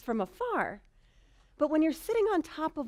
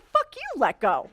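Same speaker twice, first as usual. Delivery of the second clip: outraged retort; 6 dB louder than usual. Retold or imitated. Rider snapping back at the trainer, loud and incredulous. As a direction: fling it back at her in disbelief. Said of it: "And I'm like".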